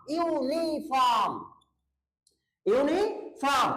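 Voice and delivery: यूनिफॉर्म stretched slowly, the yu sound clear at the start; slow pronunciation demo. Shown as a demonstration. Teacher to students, slowly sounding out यूनिफॉर्म. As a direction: draw out यूनिफॉर्म so the yu is heard. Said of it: "मैंने बोला यूनिफॉर्म".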